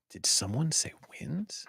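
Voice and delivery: high, breathy stage whisper. Pretend. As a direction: whisper it like a secret. Repeat of the line, whispered.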